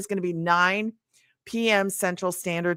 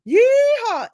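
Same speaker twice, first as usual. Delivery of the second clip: high, loud cowboy whoop; yeehaw call; 6 dB louder than usual. Shown as a demonstration. Host demonstrating her call to action for viewers, whooping. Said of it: "I say".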